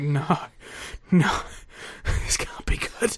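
hushed, slowed mock dread; half-whispered, slow sinking dread. Reacting to Don West's pitch, slow hushed dread. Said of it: "and I was like".

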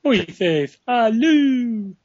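high, slow drawn-out name call; Aaron Finn impression. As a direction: stretch the name out like an announcer. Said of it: "Aaron Finn homage".

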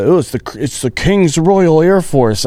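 high, drawn-out mock pomp; mocking grand title. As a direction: announce it high and slow, making fun of the name. Mocking British unit names.

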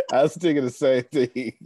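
said through laughter; laughing. Real, not acted. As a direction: repeat it while cracking up. Repeats line while laughing.

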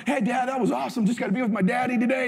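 put-on high, quick happy kid voice; little boy voice. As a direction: imitate an excited little son. Voicing young son.

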